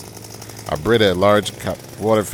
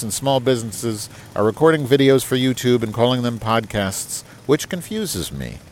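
higher, formal letter-reading voice; email read aloud; 4 semitones higher than usual. Acted. Host reading Roger's email aloud to the audience, higher and formal. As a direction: put on a proper, correspondence tone.

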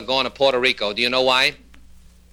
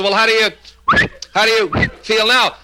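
jam after "how do you", then retry; stuttering psychiatrist; 7 semitones higher, 4 dB louder than usual. Acted. Psychiatrist checks on returning patient, stuttering. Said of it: "has got the same trouble".

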